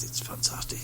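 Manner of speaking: hushed secretive whisper. Retold or imitated. Retold local man's secret tip.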